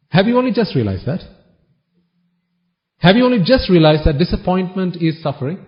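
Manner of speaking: normal talking voice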